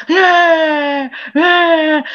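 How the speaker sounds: high, drawn-out mock wail; long, whining baby cry, hammed up; imitated child's crying. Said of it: "Jadi arek iki nangis".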